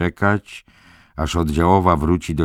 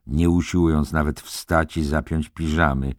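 ordinary delivery

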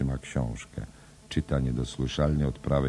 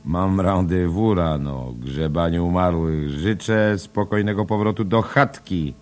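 jovial cabman; 5 semitones higher, 8 dB louder than usual. Acted. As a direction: draw it out with a wink. Kelleher voiced in read drama. (wolno, głośno, żartobliwie)

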